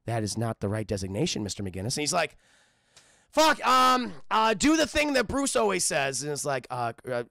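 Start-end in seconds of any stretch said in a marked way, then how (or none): 0.0-2.0 low, even computer readout; low robotic monotone. Acted. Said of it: "the computer's like". 3.3-6.2 loud, high, flustered command; exasperated and raised, with stumbles. Acted. Stumped user barking at computer.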